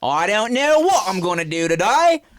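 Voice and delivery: high, loud mock Australian accent; badly faked British sliding into Australian; British accent gone Australian. Shown as a demonstration. Host showing the accent switch, loud mock Australian. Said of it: "went straight to Australian accent".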